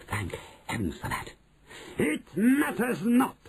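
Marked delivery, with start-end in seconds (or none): 2.0-3.4 high, slow, imperious witch; commanding high-pitched witch cry; Grand High Witch shouting. A narrator's reading. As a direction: call out dismissively, stretching the words. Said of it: "shouted the Grand High Witch".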